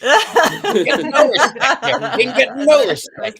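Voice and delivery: loud, high comic catchphrase impression; hammed-up stand-up comic impression; Rodney Dangerfield. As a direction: belt out a comedian's complaint through laughter. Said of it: "with Rodney Dangerfield".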